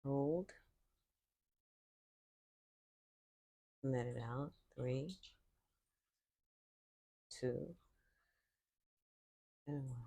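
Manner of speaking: calm, unhurried voice with long silences between cues; guided meditation. Real, not acted. Yoga teacher leading listeners into breathing, calm guided meditation. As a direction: count the breaths softly, leaving room to breathe.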